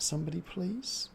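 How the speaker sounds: normal talking voice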